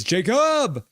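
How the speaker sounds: high, drawn-out name call. Retold or imitated. Reading one viewer's greeting to another, called out. Reads chat shout of a name.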